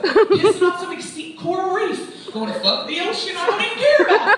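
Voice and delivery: loud mocking tirade, laughing through it; bitter ranting. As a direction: spit it out like a bitter ex. Acting bitter breakup persona.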